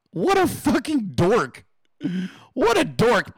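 mocking scorn. Real, not acted.

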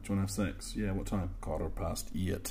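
low, soft Northern Irish seduction; sexy Northern Irish man. Acted. Imagined sexy man propositioning, Northern Irish accent. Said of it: "sexy man with a Northern Irish accent".

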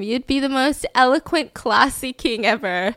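ordinary talk, nothing marked

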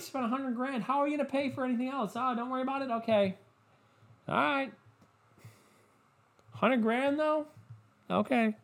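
high, soft mock-wife voice; shocked wife. Wife reacts to her husband spending a hundred grand, high and softly shocked. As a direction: put on a hushed, high-pitched shocked wife. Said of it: "she was, like, shocked".

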